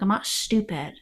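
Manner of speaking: ordinary talk, nothing marked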